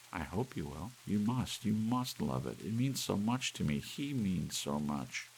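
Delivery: Doris pleading. Acted. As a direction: she begs him to love the clock.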